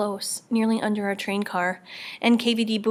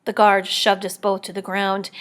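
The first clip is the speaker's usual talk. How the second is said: plain reading voice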